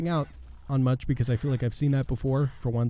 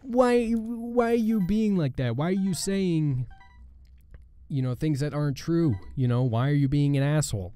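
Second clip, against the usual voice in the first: mock complainer; 6 semitones higher than usual. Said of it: "but people will be like".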